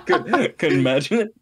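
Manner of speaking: amused, words broken by giggles; laughing. Real, not acted. Cracking up at co-host's joke.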